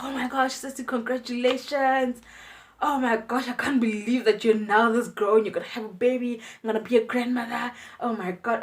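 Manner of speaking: high, bright joyful aunt; aunt overjoyed about the baby, high and loud. Retold or imitated. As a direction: burst with joy. Aunt's joyful reaction.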